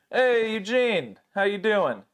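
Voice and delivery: lightly voiced breezy boss; boss's breezy greeting. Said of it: "Just calls me Eugene".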